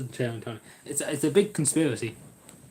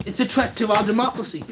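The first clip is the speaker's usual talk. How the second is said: mock outcry; 5 semitones higher than usual.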